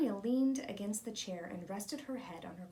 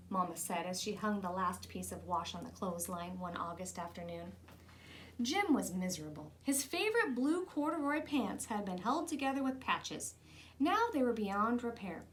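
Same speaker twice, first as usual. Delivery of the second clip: ordinary delivery, nothing marked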